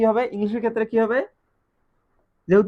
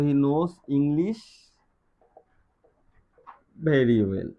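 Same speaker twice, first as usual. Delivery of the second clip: slow example reading; 4 semitones lower, much slower than usual. Shown as a demonstration. Teacher reading an example sentence.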